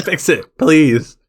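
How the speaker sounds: high, laughing mock demand; laughing plea. Real, not acted. Host begging for a schedule fix, laughing. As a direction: plead loudly through a laugh.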